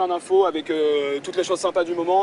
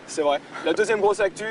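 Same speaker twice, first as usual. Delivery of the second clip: normal talking voice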